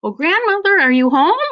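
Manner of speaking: high, loud call through the door. Pretend. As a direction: sing out to grandmother from the doorstep. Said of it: "take two rocks and say".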